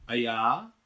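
loud, put-on protest; hypocritical cry. A narrator's reading. Nicole left out of the meeting, crying out in mock protest. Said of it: "Pokrytecky vykřikla Nicole".

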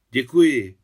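loud soldierly gratitude; loud thanks. A narrator's reading. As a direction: call out thanks on the way out. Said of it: "vyjádřil nahlas svoji vděčnost".

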